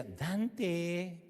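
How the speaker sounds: soft, gentle call of a name; sweet mother calling. Retold or imitated. Mother calls her son softly when there is dessert. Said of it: "Si decía".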